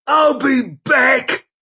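high, loud, slow snarl. A narrator's reading. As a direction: snarl it back as he's dragged. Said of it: "snarled the old man".

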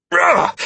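loud high effort roar; battle grunt. A narrator's reading. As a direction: grunt as you slash. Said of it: "I grunted".

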